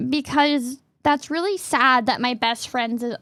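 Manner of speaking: ordinary talk, nothing marked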